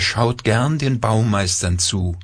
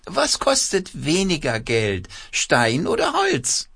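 quacking puppet voice; 8 semitones higher than usual. A narrator's reading. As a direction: squawk it out like a cloth puppet. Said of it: "mit verstellter Stimme quakt er los".

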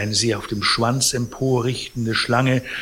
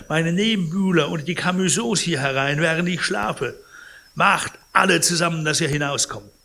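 put-on enraged old man; 5 semitones higher than usual. Pons furious at Cibot.

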